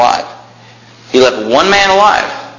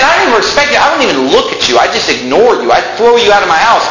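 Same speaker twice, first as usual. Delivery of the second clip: higher, heated dismissal; angry Elisha; 6 semitones higher, faster than usual. Retold or imitated. Elisha telling the wicked king he ignores him, angry. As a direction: brush the king off with scorn. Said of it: "do you see the anger?".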